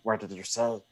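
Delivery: low, soft creature voice; Gollum. Acted. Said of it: "And Gollum's like".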